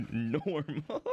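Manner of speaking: "normal" squeezed out through a laugh. Real, not acted. Vlogger teasing Midwestern accents, breaking into laughter.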